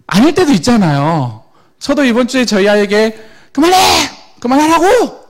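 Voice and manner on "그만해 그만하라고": put-on mother shouting stop at her child; angry shout at child. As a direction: yell at your kid to stop, fed up. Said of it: "이렇게 표현했어요".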